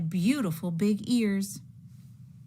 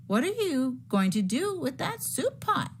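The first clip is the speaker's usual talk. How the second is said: curious bunny; 9 semitones higher than usual. Acted. Buddy asks eagerly.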